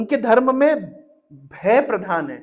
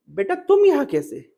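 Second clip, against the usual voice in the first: higher, surprised motherly question; changed tone; 6 semitones higher than usual. Acted. Diti, surprised, tenderly addresses Indra as son. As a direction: sound amazed yet affectionate. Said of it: "अभी दीति की भाषा बदल गई".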